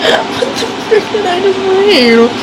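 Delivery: giggling what-the-frick; laughing disbelief. Real, not acted. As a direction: laugh it out, stunned.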